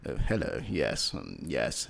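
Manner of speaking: posh accent mock. Mocking Nigerians who put on a posh English accent, low and hushed. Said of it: "put on this posh accent and say".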